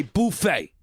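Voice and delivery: buffet opening on "boo"; boo-FAY pronunciation. Shown as a demonstration. Host insisting it's how Americans talk, buffet as "boo-FAY". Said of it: "Americans say buffet".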